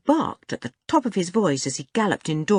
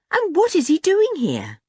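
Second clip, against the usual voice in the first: put-on high, puzzled boy's question; baffled demand; 12 semitones higher, 4 dB louder than usual. A narrator's reading. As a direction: press for an explanation, astonished. Dick keeps asking, astonished.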